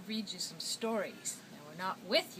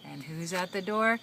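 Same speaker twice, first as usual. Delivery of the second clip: usual speaking voice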